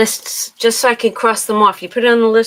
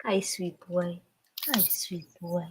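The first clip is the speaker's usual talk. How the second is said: soft, low, gentle hello; tender to the pet; 4 semitones lower, 12 dB quieter than usual. Real, not acted. Greeting her sweet boy cat, soft and tender. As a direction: murmur it lovingly to him.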